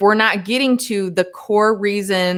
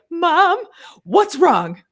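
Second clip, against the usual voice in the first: panicked mom; 10 semitones higher, much slower than usual. Retold or imitated. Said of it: "'Cause I've called her before, like".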